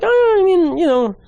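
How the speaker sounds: high, sing-song fake politeness; mocking high polite tone; mock polite doubt. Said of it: "And I'm like".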